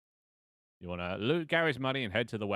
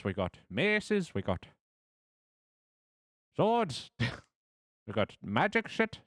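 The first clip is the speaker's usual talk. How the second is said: high, loud hammy Irish merchant; a shopkeeper hawking weapons in a crumbling Irish accent; derailing Irish accent; 7 semitones higher, 5 dB louder than usual. Acted. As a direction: sell the wares in an overblown Irish lilt. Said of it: "this accent is derailing".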